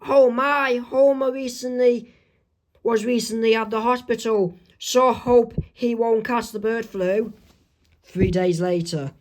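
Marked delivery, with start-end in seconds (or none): 0.1-7.3 high-pitched worried wife voice; Marge. Acted. Wife worries about Homer as she watches the news, high-pitched. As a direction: fret in a raised, slowed voice. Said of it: "this is what Marge is saying".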